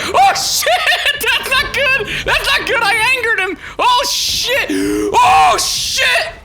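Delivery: high, loud, laughing panic; startled gamer shouting through laughter; panicked laughing shout. Real, not acted. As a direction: yelp it out half laughing in alarm. Animal attacks, panicked reaction.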